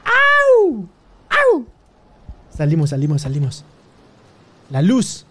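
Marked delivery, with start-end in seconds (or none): 0.0-1.6 very high loud yelp of fright; startled yelp. Real, not acted. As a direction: yelp when something jumps out. Grito de susto. (chillido de susto)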